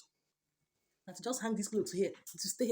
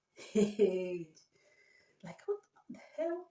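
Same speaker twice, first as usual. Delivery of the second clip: shocked outburst; 5 dB louder, much slower than usual. Real, not acted. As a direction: cry out in shock. Outburst at ruined clothes.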